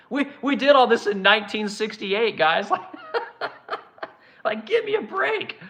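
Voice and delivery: laughs through the words, high and breathy; can't stop laughing at how absurd it is; incredulous laughter. Real, not acted. Host laughing at the idea that this counts as progress, real laughter.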